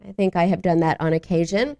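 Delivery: ordinary talk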